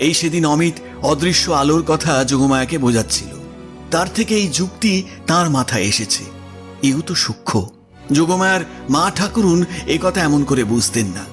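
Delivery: usual speaking voice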